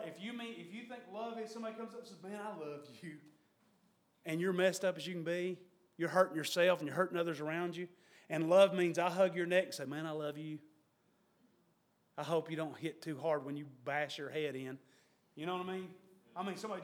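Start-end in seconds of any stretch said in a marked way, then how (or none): 2.2-3.1 soft. Acted. False loving friend quoted. 9.8-14.8 soft. Acted. False loving friend quoted.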